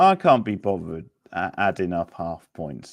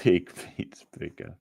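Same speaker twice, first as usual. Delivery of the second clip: laughing through the tagline; real chuckle between the words; amused laugh; 3 dB quieter than usual. Real, not acted. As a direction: break into a laugh mid-line.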